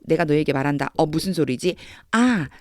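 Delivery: ordinary delivery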